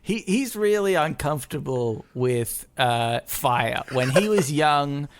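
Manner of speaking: usual speaking voice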